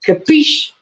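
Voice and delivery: high, far-off New York wiseguy capiche. Pretend. Booster closing the donation bit, New York mobster capiche. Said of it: "I don't know why I have a New York accent".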